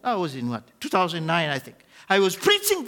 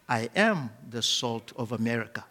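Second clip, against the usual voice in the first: put-on softly and calmly, childlike; calm, like little kids; 9 semitones lower, 4 dB quieter than usual. Shown as a demonstration. Preacher leads congregation, calm and childlike. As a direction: say it simply like a trusting child. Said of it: "just calmly, like little kids".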